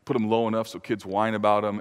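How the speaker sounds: ordinary talk, nothing marked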